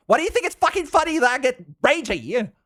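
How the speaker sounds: high-pitched, incredulous protest; mock indignant. Streamer challenges those amused by the rage, mock indignant. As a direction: demand an answer in pretend outrage.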